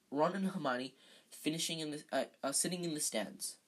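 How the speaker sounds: ordinary delivery, nothing marked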